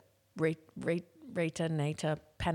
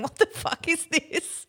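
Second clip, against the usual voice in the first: high, slow baffled voice; drawn-out confused new listener; 12 semitones higher, 6 dB louder, slower than usual. Pretend. Imagined new listeners reacting.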